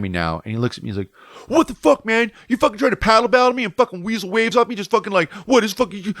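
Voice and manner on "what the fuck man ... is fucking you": mock angry surfer yelling at a rival; furious yell. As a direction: chew him out like he stole your wave. Angry surfer confronts him.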